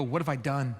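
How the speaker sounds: low, soft groggy regret; quiet morning-after dismay; hungover dismay. Acted. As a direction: mumble it, waking up to the mess. Waking up after drinking.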